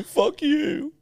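high-pitched mock retort; high squeaky retort. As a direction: squeak it out indignantly. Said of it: "you'll go".

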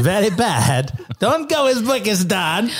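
put-on slow Borat-style accent; hammy Borat impression. Joining the Borat voice.

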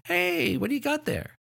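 very high sing-song greeting; adult cooing to a baby. Acted. Adult asking a baby an obvious question, very high baby-directed voice. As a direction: pitch it sky-high for a baby. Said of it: "adults asking them questions".

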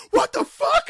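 very high, very loud wrestler's scream; imitated scream. Retelling an in-match outburst to co-hosts, screaming. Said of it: "M J F screaming".

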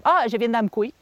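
usual speaking voice

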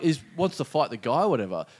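usual speaking voice

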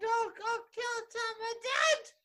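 high silly voice. Pretend. Silly put-on voice.

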